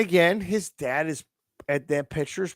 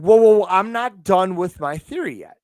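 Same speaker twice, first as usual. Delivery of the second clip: raised voice, fending off the interruption; loud protest; 3 semitones higher, 8 dB louder than usual. Real, not acted. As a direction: talk over him and hold the floor. Cut off, insists on finishing.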